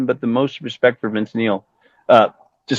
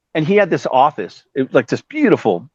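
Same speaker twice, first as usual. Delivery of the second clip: normal talking voice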